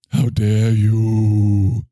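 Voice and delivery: very slow, drawn-out mock outrage ending on a rise; hammed-up indignant catchphrase; Greta Thunberg voice. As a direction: stretch it out theatrically. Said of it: "Hey Greta".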